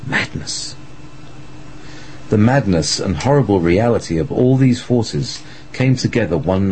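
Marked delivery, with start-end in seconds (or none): 0.1-0.8 whispered. A narrator's reading. Dramatic whispered word.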